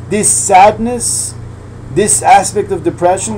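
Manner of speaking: measured reading with translation; sermon reading. Acted. Teacher reading text to students, measured and steady. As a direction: read the holy text, then explain. Reads and translates Reb Nosson.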